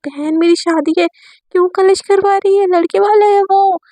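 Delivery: put-on worried older sister begging her to behave; pleading bride. Bride Aisha begs Divya not to fight at her wedding, pleading.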